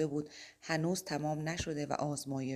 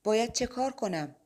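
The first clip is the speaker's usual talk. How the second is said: higher, louder desperate cry; shouted question; 6 semitones higher, 5 dB louder than usual. Acted. Mike shouts to the silent angels, a desperate cry. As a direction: call out for an answer. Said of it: "مایک فریاد زد".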